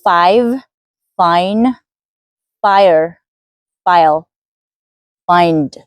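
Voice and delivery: five, fine, fire, file, find with crisp final consonants; final sounds clear. Shown as a demonstration. Teacher modelling clear word endings. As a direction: land every ending sound. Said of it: "distinguish between those words".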